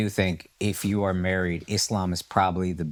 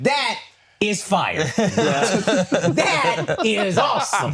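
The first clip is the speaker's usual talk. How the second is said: high, loud and laughing; laughing delight; 12 semitones higher, 4 dB louder, much slower than usual. Retold or imitated. Comedian hearing that God forbids Valentine's, laughing high. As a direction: laugh through it, thrilled.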